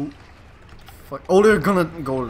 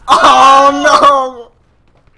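shout; 11 semitones higher, 17 dB louder than usual. Real, not acted. Sudden game death, cry out.

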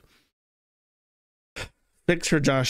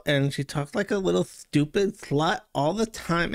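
ordinary talk

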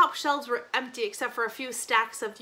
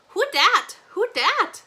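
put-on high, loud toddler squawk; piercing baby-talk demand; small child's piercing voice; 9 semitones higher, 9 dB louder, slower than usual. A narrator's reading. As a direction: pipe up like a little child. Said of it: "said Poppy in her small, piercing voice".

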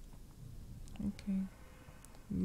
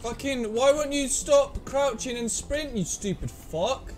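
angry yelling; 11 semitones higher than usual. Real, not acted. Yelling at AI teammate.

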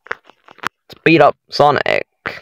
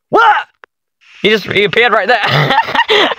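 high-pitched incredulous outburst; startled disbelief; 9 semitones higher than usual. Real, not acted. Player sees Sonic suddenly appear, startled disbelief. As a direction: exclaim it, caught off guard.

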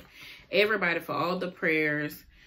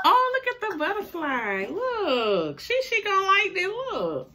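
excited; 12 semitones higher, 5 dB louder than usual. Real, not acted. Mom excited over butterfly shirt.

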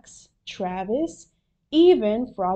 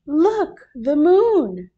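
high, loud exclamation; pitched up, loud and eager; excited cry; 6 semitones higher, 8 dB louder, slower than usual. A narrator's reading. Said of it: "cried Froggy".

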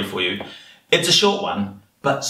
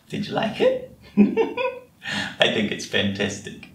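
ordinary delivery

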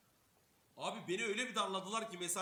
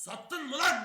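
loud, higher accusing bark; angry bark; 8 semitones higher, 14 dB louder, slower than usual. Retold or imitated. Angry sergeant accuses the soldier of selling the rifle, loud bark. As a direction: bark it like you caught a traitor.

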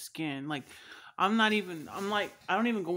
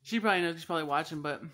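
ordinary talk

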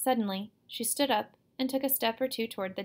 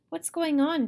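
higher, louder alarmed question; raised, worried voice; father's shout; 7 semitones higher, 6 dB louder than usual. A narrator's reading. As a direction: call out to find out what's wrong. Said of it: "Dad shouted".

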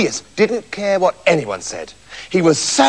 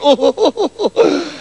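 higher-pitched cocky chuckle; boastful laugh; 5 semitones higher than usual. A narrator's reading. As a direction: laugh smugly at your own boast. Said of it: "arrived at the palace and boasted".

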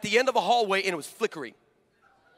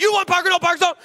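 high, loud frantic babble; hammed-up loud crazy-man rant; gibberish ranting figure; 9 semitones higher, 6 dB louder, faster than usual. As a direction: rant in garbled broken words. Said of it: "this thing talked back".

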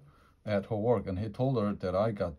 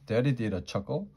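ordinary delivery

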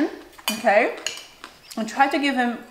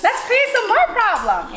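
much higher, sly voice; mock scheming girl; 11 semitones higher than usual. Girls getting together to make trouble, much higher mock voice. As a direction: cook up trouble gleefully. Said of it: "we're like".

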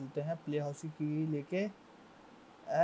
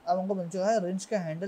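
normal talking voice

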